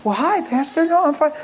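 high, light imitation of a caller; lightly voiced caller saying she's okay; retold phone reply. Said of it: "She said".

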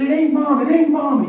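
high, loud, distraught son pleading; upset cry. Retold or imitated. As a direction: cry it out like a boy who's had enough. Upset son cries out to dad.

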